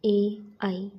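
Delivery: usual speaking voice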